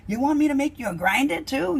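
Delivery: put-on Boston accent. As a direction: mimic the Boston fish guy offering to grind it. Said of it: "You know, like that".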